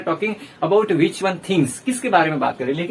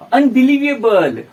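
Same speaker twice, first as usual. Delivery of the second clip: slow, louder, drawn-out "unbelievable"; disbelief; 4 semitones higher, 6 dB louder, much slower than usual. Shown as a demonstration. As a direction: stretch it out in disbelief. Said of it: "इस प्रकार से भी". (यकीन न होने वाले अंदाज़ में धीरे और ज़ोर से)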